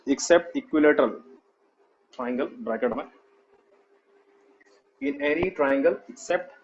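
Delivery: very slow, measured note dictation; slowly, leaving time to write. Real, not acted. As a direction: dictate phrase by phrase with long pauses. Teacher dictates notes to students.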